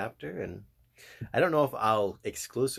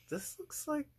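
high squeaky voice; 9 dB quieter than usual. Retold or imitated. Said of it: "So I was like".

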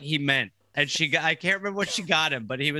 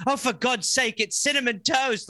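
higher, exasperated mum; lightly voiced fed-up mother; 8 semitones higher than usual. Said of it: "she was like".

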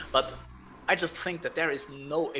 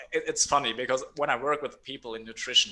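ordinary talk, nothing marked

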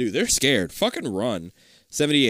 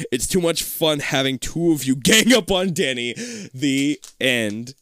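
normal talking voice

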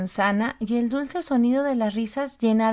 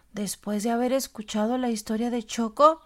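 plain reading voice

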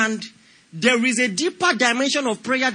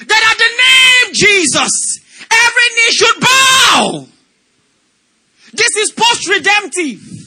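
preaching; 7 semitones higher, 6 dB louder than usual. Acted. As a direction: preach with full fervor. Preaching at full force.

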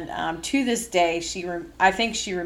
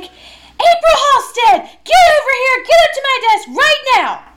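furious boss yell; 13 dB louder than usual. Retold or imitated. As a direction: yell her name across the room. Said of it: "stomping over".

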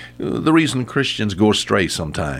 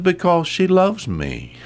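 put-on little girl; 9 semitones higher than usual. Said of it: "thought for a moment and said".

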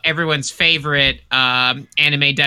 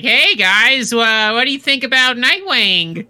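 higher, louder stand-up pitch; raised-pitch mimicry of a hacky comedian; mock touring comedian; 7 semitones higher, 3 dB louder than usual. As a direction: imitate a comic working the crowd with a tired bit. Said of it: "Jordan just takes his same jokes across the country, like".

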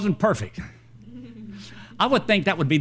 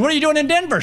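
surprised question; 8 semitones higher, much faster than usual. Retold or imitated. Preacher asking his seatmate, pitched up in surprise. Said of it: "I said".

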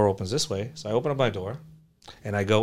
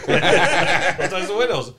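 laughing mock rule; 9 semitones higher, 10 dB louder, much slower than usual. Repeating his shop rule laughing.

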